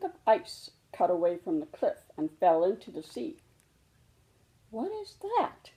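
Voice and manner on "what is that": very high, soft frightened question; a small cub shrinking from the roar; startled little cub. Acted. Little cub hears the glacier crash, startled and scared.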